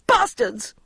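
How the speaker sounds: put-on shrill drunken yell. A narrator's reading. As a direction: yell the curse high. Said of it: "yelled Jude".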